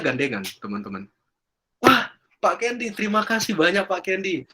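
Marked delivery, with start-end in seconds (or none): 1.8-4.4 grateful. Retold or imitated. Grateful couple returns.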